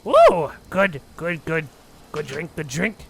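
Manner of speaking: loud, simple-minded server voice; gruff tavern keeper offering drinks; Clark's broken-speech voice. Acted. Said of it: "and goes".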